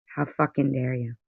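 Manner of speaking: quiet, spent indignation; softly appalled; hushed outrage. Real, not acted. Repeats outrage under breath.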